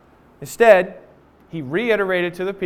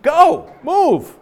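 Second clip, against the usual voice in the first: high, louder hurry-up push; impatient God; 8 semitones higher, 4 dB louder, slower than usual. Retold or imitated. God telling Moses to get moving, high impatient push. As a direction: urge them on, fed up. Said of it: "God's like".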